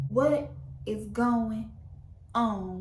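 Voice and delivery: WHAT IS GOING, long pause, ON; stunned slow question. Retold or imitated. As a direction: drag out "What is going ... on?" in disbelief. Acted stunned, exasperated question.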